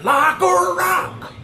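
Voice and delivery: high, drawn-out rock belt; old truck-commercial singer. Retold or imitated. Vlogger mocking how his verse sounded, belted commercial howl. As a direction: wail it like an over-the-top ad jingle. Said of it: "He sounded like the old motherfucking Ford truck commercials".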